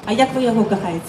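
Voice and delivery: ordinary talk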